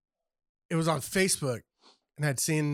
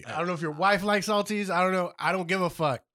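ordinary delivery

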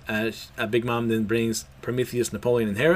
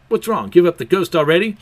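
mocking taunt; 6 semitones higher, 7 dB louder than usual. Big Mom taunts.

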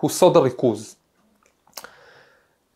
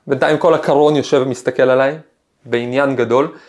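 ordinary delivery

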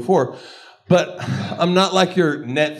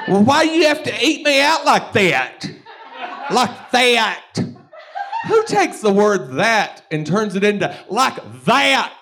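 mock outrage; 8 semitones higher than usual. Acting out the guy cussing her out, indignant. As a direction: demand it with fake offense.

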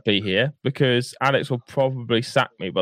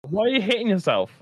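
higher, teasing put-on voice; higher mock voice; 8 semitones higher than usual. Bus driver teasing the passenger, higher voice. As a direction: tease him like a playground bully.

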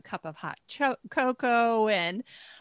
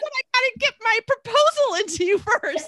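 eager plea; 13 semitones higher, 7 dB louder than usual. Retold or imitated. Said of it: "And I was like".

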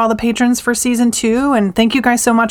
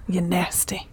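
low murmur; 4 semitones lower, 12 dB quieter than usual. Acted. Teasing catchphrase.

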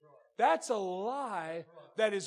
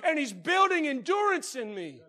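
loud, high, fervent preaching; shouting preacher; 9 semitones higher, 12 dB louder than usual. Acted. Preacher rousing the church, loud and high. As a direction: lift the whole room with a shout.

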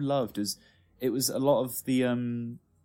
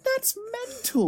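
much higher, slow, disbelieving; Brent saying mental; slower than usual. Retold or imitated. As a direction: stretch it out in outrage. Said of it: "the way he says mental".

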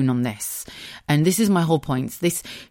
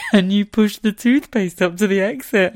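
louder, high mocking replay of herself; self-mocking; 6 semitones higher, 6 dB louder than usual. Wife mocking her own toothpaste request, laughing and incredulous. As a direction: make your own request sound ridiculous, near laughter. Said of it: "That's how much of a dick I sound".

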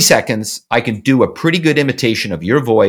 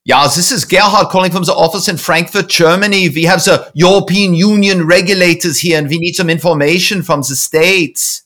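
higher, put-on German accent with z for th; 4 semitones higher than usual. Shown as a demonstration. Caller posing as Gerhard from Frankfurt, German accent. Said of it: "do accents".

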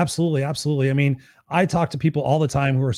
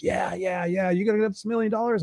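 mock eager crowd; 5 semitones higher, 3 dB quieter than usual. Crowd eagerly answering speaker.

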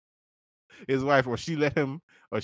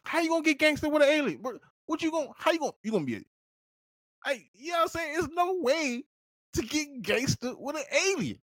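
incredulous rant; 13 semitones higher, 3 dB quieter than usual. Real, not acted. Ranting at the audience.

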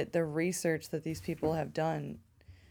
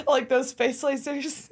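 laughing; 8 semitones higher, 6 dB louder, slower than usual. Real, not acted. Joking about space lasers.

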